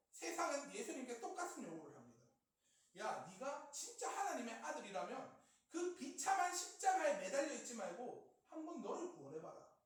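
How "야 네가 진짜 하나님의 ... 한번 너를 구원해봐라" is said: raised, goading challenge; mocking temptation. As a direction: jeer and dare him to prove himself. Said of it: "조롱하고 유혹하고 있다". (조롱하며 부추기는 말투)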